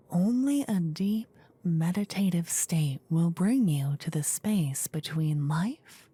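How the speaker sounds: ordinary delivery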